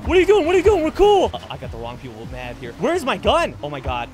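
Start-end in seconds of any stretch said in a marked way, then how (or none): 0.0-1.3 high, loud pleading yell; panicked yelling. Real, not acted. Pleading with attackers in game. 2.8-3.5 frantic shout. Real, not acted. Scrambling for weapon in game.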